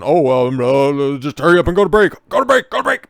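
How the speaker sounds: mock panicked anchor. Anchor mocked for dodging the question, hammed-up panicked shout. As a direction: pretend you're begging to go to break.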